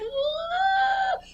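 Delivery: crushed little squeak; squeaky dismayed oh. Retold or imitated. Kid told he'll find out when he's older, crushed.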